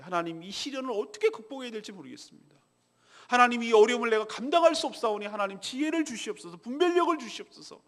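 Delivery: mildly plaintive prayer, rising toward the end; plaintive plea. Retold or imitated. Preacher voicing a believer's humble plea, mildly plaintive. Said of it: "이렇게 애처롭게 하나님께 간구하고".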